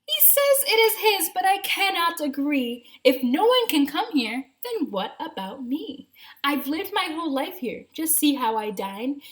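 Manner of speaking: high, squeaky rabbit voice; a chirpy little rabbit insisting. Acted. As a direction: pitch it up like a small bunny. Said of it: "a rabbit who started to speak".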